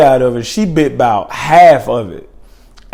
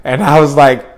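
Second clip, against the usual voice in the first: normal talking voice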